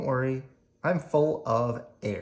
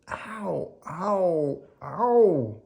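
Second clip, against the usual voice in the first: high pained ow; 7 semitones higher, much slower than usual. Acted. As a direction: yelp it out like it hurts. Child in pain.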